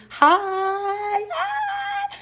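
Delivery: high, sing-song hi; squeaky greeting. Real, not acted. Greeting a new viewer in a squeaky voice. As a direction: chirp a playful hello.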